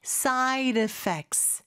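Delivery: "side effects" drawn out, D softened to a flap like a Korean ㄹ; soft flapped D. Shown as a demonstration. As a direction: slowly link "side effects" with a light tapped D. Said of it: "side의 D 발음이 모음 소리 사이에 껴서 리을처럼". ("side effects"의 D를 리을처럼 부드럽게 천천히)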